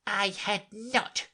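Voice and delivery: slow, high, cutting denial; sharp angry cry. A narrator's reading. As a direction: snap it out, very angry. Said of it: "cried the mouse sharply and very angrily".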